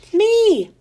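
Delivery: loud eager call. A narrator's reading. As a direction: shout it out. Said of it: "a voice called out".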